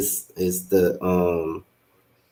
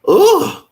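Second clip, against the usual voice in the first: strained sneeze gasp; 8 dB louder than usual. Real, not acted. Fighting a sneeze.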